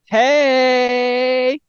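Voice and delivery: high, long drawn-out hey; putting on a village act. Acted. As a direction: call out a showy, high-pitched greeting. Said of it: "what do you say coming into this village".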